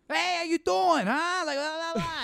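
very high, loud squawking caricature; loud Rhode Islander. Acted. Imitating loud people in Rhode Island, high loud squawk. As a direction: holler a greeting way up high. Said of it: "it's too loud".

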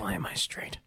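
soft unvoiced echo; whisper. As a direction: breathe it out quietly.

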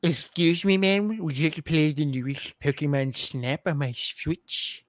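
lightly voiced fedora guy, soft and slow; a hint of a polite neckbeard; nasal smug neckbeard. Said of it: "Tips Fedora".

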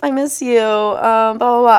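slow, drawn-out mocking whine; a lightly voiced needy ex, slowed; mock guilty ex. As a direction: parody him pining, slowly. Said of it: "your guilty conscience was like".

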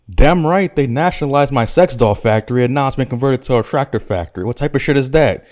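indignant Macker. Acted. Macker griping to the table about his nationalized factory, indignant. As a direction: burst in with a comic rant. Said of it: "says Macker".

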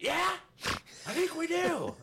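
very high, loud sneering retort; hostile old man. Retold or imitated. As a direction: hit back with a sneer.

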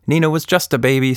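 ordinary delivery

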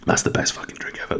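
breathy whisper after a sip; whispered delight. Real, not acted. Savouring a sip of Tropicana, a real whisper. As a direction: whisper it like a secret pleasure.

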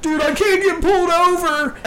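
hammed-up high, frantic wail; panicked shouting. Driver fleeing the cops, mock panicked shout. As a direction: imitate a driver losing it.

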